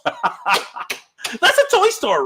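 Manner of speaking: slow, high words through real laughter; giggling at the toy store shot; laughing. Real, not acted. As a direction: laugh it out, drawn out.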